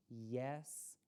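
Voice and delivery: ordinary delivery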